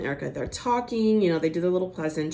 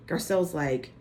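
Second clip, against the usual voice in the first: ordinary talk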